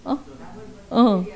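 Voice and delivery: ordinary talk, nothing marked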